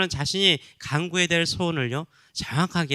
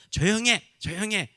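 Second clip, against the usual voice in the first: high, sharp rebuke; scolding; 7 semitones higher, 3 dB louder than usual. Retold or imitated. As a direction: crowd telling him to shut up. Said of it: "꾸짖는 거예요". (높고 날카롭게 꾸짖는 목소리)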